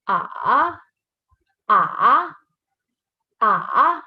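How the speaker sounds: "ah" dipping down, then rising back; third tone. Shown as a demonstration. Teacher demonstrates the third tone, dipping "ah". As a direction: hook "ah" in the throat, fall then rise. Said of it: "like a fall and then a".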